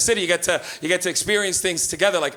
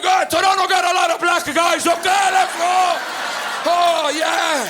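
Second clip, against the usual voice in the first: put-on high, loud, slow accented shout; pleased with new knowledge; convinced Portuguese dad; 9 semitones higher, 4 dB louder, slower than usual. Dad believing the joke answer, loud accented glee. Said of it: "he was like".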